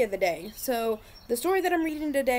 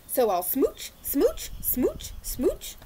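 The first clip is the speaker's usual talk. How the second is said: higher playful smooching voice; playful smooches; 5 semitones higher, much slower than usual. Acted. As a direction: pitch each smooch up like blowing kisses. Fish giving kisses.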